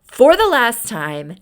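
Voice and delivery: loud, slow angry command; loud vampire snarl; vampire fury. Retold or imitated. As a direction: throw him out with menace. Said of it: "full vampire mode".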